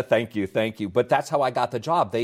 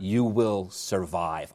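drawn out word by word, dropping lower; slow, weighty quote; 4 semitones lower, 4 dB quieter, much slower than usual. Retold or imitated. Host quoting the guest's line back to him, slow and weighty. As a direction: deliver it very slowly as a memorable line.

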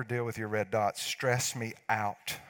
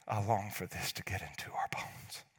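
hushed, breathy whispered longing; whispered plea; 3 semitones lower, 7 dB quieter than usual. Real, not acted. Pastor whispering to the congregation, a hushed plea. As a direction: whisper it like a heartfelt prayer.

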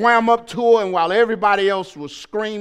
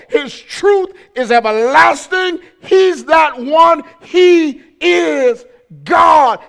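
loud, high, impassioned sermon delivery; full-force preaching; 6 semitones higher, 7 dB louder than usual. Real, not acted. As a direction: drive each phrase home, stretching key words.

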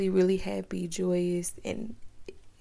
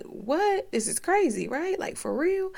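shocked disbelief; 10 semitones higher than usual. Retold or imitated. Retold shocked reaction with husband.

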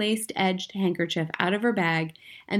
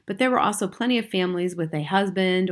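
ordinary delivery

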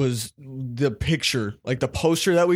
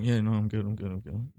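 much softer, lower, trailing away; trying to sound normal; 6 semitones lower, 8 dB quieter than usual. Retold or imitated. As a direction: mumble it small so dad won't notice. Faking normal to his dad.

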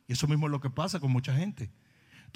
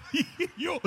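laugh. Real, not acted. Laugh before retold reply.

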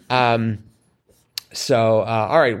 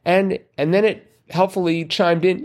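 usual speaking voice

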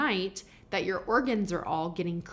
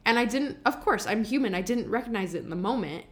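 normal talking voice

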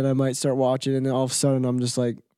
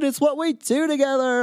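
whiny voice; 11 semitones higher than usual. Retold or imitated. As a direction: imitate a partner begging in a whine. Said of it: "it's a whiny voice".

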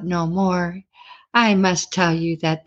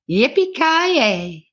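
high, long drawn-out playful call; cowgirl whoop; 7 semitones higher, much slower than usual. Acted. Cowgirl waves her hat at her date, whooping. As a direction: stretch it out, soaring up and back down.

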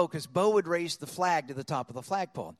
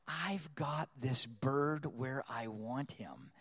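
slow, low, softer savoring; quiet gloating; 5 semitones lower, 9 dB quieter, much slower than usual. Retold or imitated. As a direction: relish the upper hand under your breath. Gloating thought once bird is caught.

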